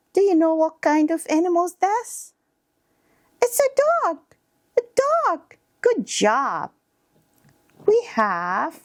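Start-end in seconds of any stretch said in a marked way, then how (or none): 3.4-5.6 put-on very high-pitched child answering; squeaky small kid naming an animal; little child.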